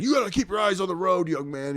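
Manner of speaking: high, quick lecturing cop voice; acted officer's telling-off; scolding cop. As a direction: scold a young driver as a cop.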